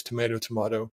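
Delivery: tomato as "to-MAY-to", then tomato as "to-MAH-to"; both pronunciations. Shown as a demonstration. Showing they say it both ways, "to-MAY-to, to-MAH-to". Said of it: "I say it both ways".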